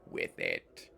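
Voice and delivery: very low, very slow, ponderous statue; stone giant voice. Acted. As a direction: grind out each word like rock.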